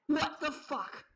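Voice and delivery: ordinary talk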